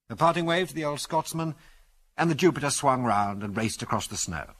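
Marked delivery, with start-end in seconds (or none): none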